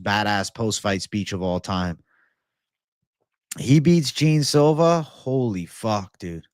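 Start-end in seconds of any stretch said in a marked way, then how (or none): 3.6-5.0 excited. Real, not acted. Hyping an imagined win.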